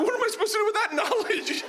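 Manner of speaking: very high, incredulous outburst; mock-hysterical protest; exasperated disbelief. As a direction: squeal it in comic despair. Comic's exasperated reply to trainer.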